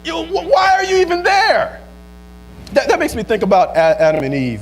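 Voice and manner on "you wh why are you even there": high, exclaimed command; emphatic urging. Acted. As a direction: cry out the order to get out. Urges the mistreated to leave.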